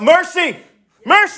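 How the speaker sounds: very high desperate cry; shouting out. Acted. Blind man shouting for mercy, high desperate cry. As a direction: cry it out, rising each time. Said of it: "he kept shouting out".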